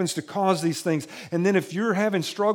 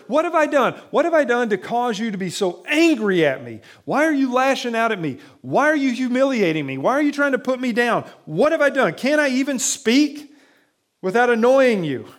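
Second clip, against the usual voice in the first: higher and louder pleading protest; exasperated outcry; 6 semitones higher, 5 dB louder than usual. Retold or imitated. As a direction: cry out, hurt and fed up. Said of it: "He's exasperated".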